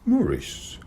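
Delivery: put-on high, careful young boy. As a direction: say it warily, like a cautious kid. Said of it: "said the boy carefully".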